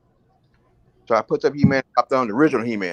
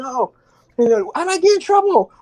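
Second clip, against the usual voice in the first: mock teacher voice; 3 dB louder than usual. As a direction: whine it like a prissy substitute teacher. Teacher worries.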